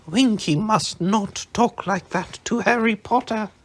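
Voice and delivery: high, loud scolding elf; angry elf. A narrator's reading. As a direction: scold her hotly. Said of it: "said Dobby angrily".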